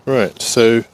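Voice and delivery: ordinary talk, nothing marked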